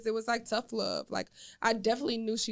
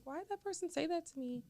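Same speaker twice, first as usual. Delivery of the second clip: confused murmur; 6 semitones higher, 11 dB quieter than usual. Retold or imitated. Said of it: "Just be sitting there like".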